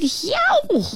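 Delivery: high, sudden mock yowl; animal yowl. A narrator's reading. Fuzzy thing yowls at the Nobble, startling high yowl. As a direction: let out a high-pitched 'yow'. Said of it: "oh, the noise it made".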